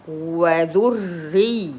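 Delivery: slow وذري with a long doubled r. Shown as a demonstration. Teacher shows shadda as two ras, doubling the r in وذري. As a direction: hold the r of وذري as two letters. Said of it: "as if there are two راء".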